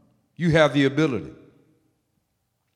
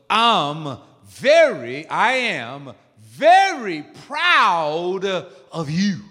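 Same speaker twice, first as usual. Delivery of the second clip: very slow, stretched-out praise; emphatic affirmation; 2 semitones higher, 6 dB louder, much slower than usual. Shown as a demonstration. Preacher modelling praise to the congregation, slow and emphatic. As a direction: draw out each word with weight.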